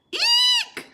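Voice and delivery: very high, loud eek shriek; a piercing hammed-up shriek. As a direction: shriek in fright. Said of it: "cried the three bears".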